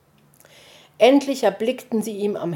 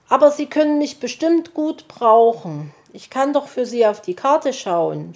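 high, keen offer of help; eager suggestion; 6 semitones higher, 5 dB louder than usual. A narrator's reading. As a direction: pitch yourself as a helper. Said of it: "schlug Borstel vor".

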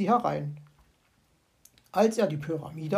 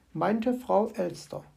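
ordinary delivery, nothing marked

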